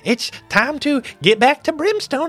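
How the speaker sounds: chirpy goblin announcing departure; goblin captain. Acted.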